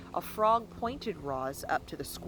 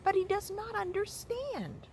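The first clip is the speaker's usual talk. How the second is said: much higher, level robot tone. A narrator's reading. Robot telling the goose, high and even. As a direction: state it plainly like a machine.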